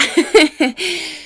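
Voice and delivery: high, loud giggle breaking out; bright burst of laughter. Real, not acted. Wrapping up a tearful tangent, she bursts into high laughter. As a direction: laugh it off after the emotional tangent.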